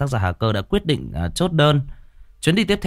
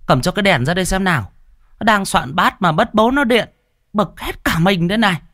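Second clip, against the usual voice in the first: fussing neighbour woman; 10 semitones higher, 3 dB louder than usual. A narrator's reading. Neighbour women calling amid bustle.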